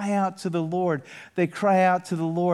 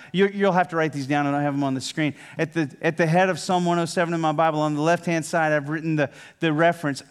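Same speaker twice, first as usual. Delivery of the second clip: normal talking voice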